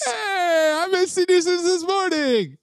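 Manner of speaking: very high, drawn-out mock-tearful delight; excited squeal. Imitating a man so excited to see him, high excited squeal. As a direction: imitate someone squealing, overjoyed at seeing him. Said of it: "He gets so excited when he sees him".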